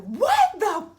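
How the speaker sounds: high, loud, shrieking disbelief; loud mock outrage with a laugh in it; outraged best friend. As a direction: shriek it like a friend who can't believe what she's seeing. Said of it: "She was like".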